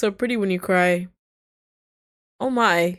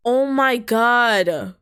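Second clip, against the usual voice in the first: drawn-out, higher, falling cry of shock; slow, raised-pitch disbelief; 4 semitones higher, 4 dB louder, much slower than usual. Real, not acted. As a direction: stretch it out slowly, appalled. Shocked reaction to the game scene.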